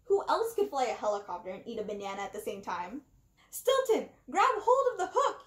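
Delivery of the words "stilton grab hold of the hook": much higher and louder call; loud high yell. A narrator's reading. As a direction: shout up over the helicopter noise. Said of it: "he yelled".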